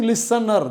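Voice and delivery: "listener" with a sounded final R; regional pronunciation. Shown as a demonstration. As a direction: end "listener" on a clear R. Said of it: "that is the regional pronunciation".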